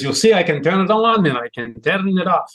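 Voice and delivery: imitating a heavy German accent in English; playful imitation of a heavy German accent. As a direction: mimic Frank's put-on heavy German accent. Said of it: "switched into English with a heavy German accent".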